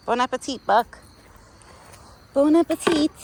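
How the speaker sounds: high, loud playful call; cheerful pet talk. Real, not acted. Wishing Buck a good meal, high and bright. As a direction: sing it out to the animal with delight.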